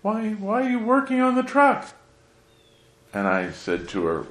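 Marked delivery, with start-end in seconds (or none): 0.0-1.9 high, put-on voice of a pampered aunt; imitating rich aunt. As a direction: squeak it out like a fussy rich auntie. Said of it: "was saying this".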